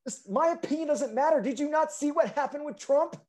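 high squeaky whining; mock high whiny voice. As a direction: whine it like a caricature.

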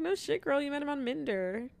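retold teasing reply. Telling her friend the obvious, high teasing retort. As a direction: tease a friend about the obvious.

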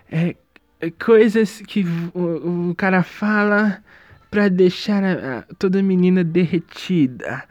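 slow, lightly put-on seductive voice; mock suave guy. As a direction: drawl it like a smug TikTok charmer. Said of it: "o jeito que eu falei foi muito mais eloquente". (imitando com voz lenta de galã)

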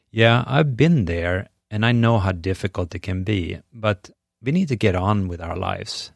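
normal talking voice

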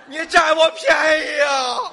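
loud, high comic outrage; mock indignant shout. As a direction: cry foul at a cheeky stage partner. Straight man answers to "son.

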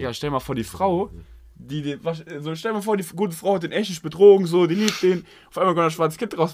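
high, loud panicky ramble; panicked rant. Acted. Husband panics, pitch climbs.

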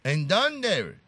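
put-on short question in a gringo accent; mock English. Host checking Eva's answer, mock English accent. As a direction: fake American English on Spanish words. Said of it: "otra pregunta en inglés".

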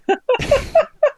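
high, loud burst of laughter; shocked laughter. Real, not acted. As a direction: crack up suddenly.

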